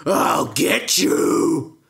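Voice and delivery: loud, slow giant roar; angry giant shout. Acted. Giant shouts at Jack chopping, slow loud roar. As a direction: roar it slowly and loudly.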